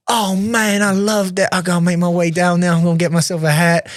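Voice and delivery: high, thrilled Texan impression; Texas Southern accent. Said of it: "I'm trying to do the accent here".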